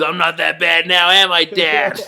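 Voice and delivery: a hammed-up son's plea; mock pleading son. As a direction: ask Dad for reassurance in character. Quoting son to dad.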